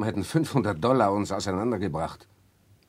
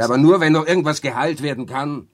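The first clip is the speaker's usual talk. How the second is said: loud retort; 4 semitones higher, 11 dB louder than usual. Acted. Victor retorting loudly.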